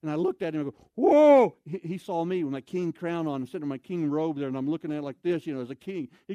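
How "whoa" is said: loud, high shout of shock; booming high-pitched whoa; startled whoa. Retold or imitated. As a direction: recoil with a big "Whoa!". Reacting to the man in doorway.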